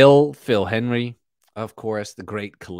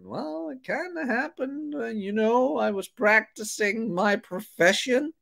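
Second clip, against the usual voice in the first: much higher, hesitant mock voice; sheepish customer; 12 semitones higher than usual. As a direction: squirm while confessing the fire. Sheepish customer explaining to insurer.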